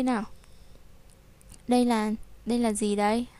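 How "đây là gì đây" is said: normal talking voice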